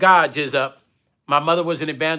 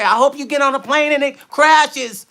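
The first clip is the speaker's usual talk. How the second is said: higher, sharper take on the ex-wife's curse; imitating the ex-wife's nasty line, voice raised; mocking ex-wife; 8 semitones higher, 3 dB louder than usual. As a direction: mimic her spiteful wish, pitched up. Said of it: "This is shit she would say".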